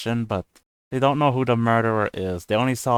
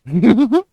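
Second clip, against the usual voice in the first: sudden high burst of laughter; loud laugh; 12 semitones higher, 12 dB louder than usual. Real, not acted. Laughs at own villain boast.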